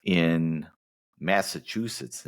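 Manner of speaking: normal talking voice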